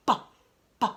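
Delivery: the sound B makes, said apart; letter sound. Shown as a demonstration. Teacher sounding out B for young readers, letter sound. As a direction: isolate the "b" sound for a child. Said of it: "I'm gonna say the sound B makes".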